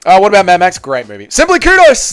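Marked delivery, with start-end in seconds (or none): 1.3-2.1 high voice. Pretend. Reading donor name.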